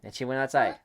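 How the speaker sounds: ordinary delivery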